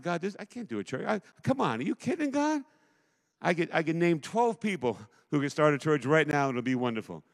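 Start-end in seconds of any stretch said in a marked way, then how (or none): 0.0-2.6 incredulous protest. Retold or imitated. Pastor retelling his plea to God, rising disbelief. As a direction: pitch climbing much higher in disbelief. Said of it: "I said".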